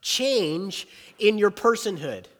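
loud, high, driving preacher voice; preaching. Acted. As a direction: preach it out to the whole room. Preaching at full force.

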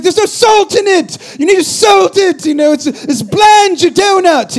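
high, drawn-out mock British shouting; Gordon Ramsay. British TV chef yelling at kids about food, hammed-up accent. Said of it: "he's, like, yelling at the kids".

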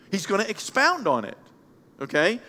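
ordinary talk, nothing marked

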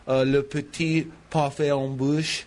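"le petit parfait en bouche" with a put-on French accent; mock French accent for the dessert name. Shown as a demonstration. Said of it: "I like when you speak French".

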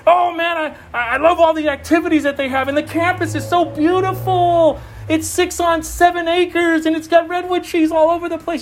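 high, sing-out parody of enthusiasm; exaggerated delight, pitched high; mock gushing. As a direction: rave like a dazzled churchgoer, hammed up. Mimics fans of the campus.

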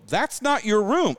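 a guest spelling it out to the lost woman; firm and slow. Retold or imitated. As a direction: spell it out slowly. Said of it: "I go".

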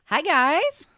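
squeaky, cracking hello. Real, not acted. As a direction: voice squeaks up high on the greeting. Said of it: "my voice just squeaked there like Peter Brady".

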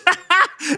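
high jeering chuckle; mocking laugh. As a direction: laugh at him. Soldier laughs.

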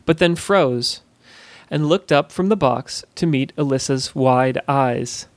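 ordinary delivery, nothing marked

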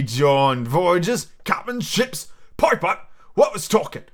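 high, mocking interrogation; blustering captain demanding answers; berating pirate innkeeper. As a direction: grill the sailor with scornful repeats. Plays Silver berating Morgan.

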